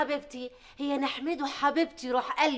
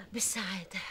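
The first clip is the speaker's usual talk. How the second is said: breathy whispered bliss; 7 semitones lower, 9 dB quieter than usual. Acted. Mother-in-law whispering her delight. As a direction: whisper it dreamily. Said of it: "حسيت بشكشكه".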